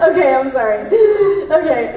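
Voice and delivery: apologizing through laughter; laughing apology. Real, not acted. Actress apologizing in the booth, laughing. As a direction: giggle out the apology. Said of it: "I can't stop laughing".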